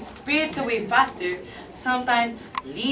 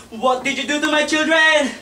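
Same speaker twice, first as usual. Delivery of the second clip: louder, higher accusing repeat; accusing cry; 4 semitones higher, 5 dB louder than usual. Acted. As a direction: throw the question at him. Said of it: "Her screams and lamentations".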